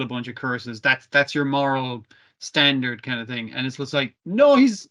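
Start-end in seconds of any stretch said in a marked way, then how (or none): 4.2-4.9 exasperated outburst. Real, not acted. Protesting the villains' logic.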